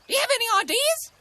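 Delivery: high, loud, quick magpie voice; high-pitched cartoon magpie; magpie character. A narrator's reading. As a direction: ask it fast in a loud bird voice.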